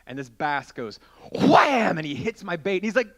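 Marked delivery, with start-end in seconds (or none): 1.3-2.0 loud, high burst on the strike; explosive exclamation. Retold or imitated. Storyteller acting out the bass hitting his bait, explosive. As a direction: blast it out as a sudden impact.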